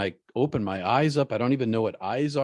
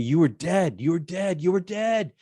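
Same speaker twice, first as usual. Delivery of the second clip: high-pitched pleading cry, rising with each repeat; mock scream without full volume, pitch climbing; retold scream; 7 semitones higher than usual. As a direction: imitate someone screaming in distress, higher each time. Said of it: "he's screaming at me".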